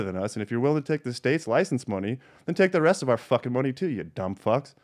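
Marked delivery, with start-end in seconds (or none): none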